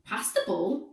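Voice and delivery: ordinary delivery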